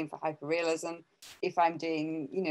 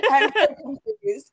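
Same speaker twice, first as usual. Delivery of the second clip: much higher, louder, helpless laughter; laughing; 9 semitones higher, 9 dB louder than usual. Real, not acted. Speaker muddled by accents while learning languages, laughing hard. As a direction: squeal it out through real laughter.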